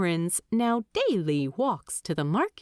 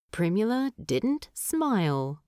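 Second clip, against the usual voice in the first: ordinary delivery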